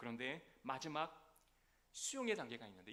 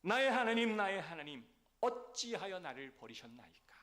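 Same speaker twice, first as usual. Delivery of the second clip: loud, high cry of abandonment to God; anguished cry; 5 semitones higher, 10 dB louder than usual. Acted. Christ's cry on the cross.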